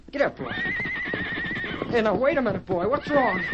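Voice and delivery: rising alarm, calling out to a spooked horse; urgent calling to horse. Acted. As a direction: shout up at the frightened horse. Boy's horse rears in fear.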